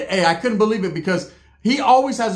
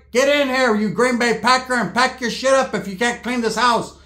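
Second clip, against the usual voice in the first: raised, mock-angry aunt voice; higher-pitched imitated bossy tirade; aunt ordering someone in; 5 semitones higher than usual. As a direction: summon and scold as the fed-up aunt. Acts aunt calling and scolding.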